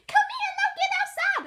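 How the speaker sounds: very high, loud, laughing shriek; high shrieking girl. Acted. Molly calling the dog, high loud shriek.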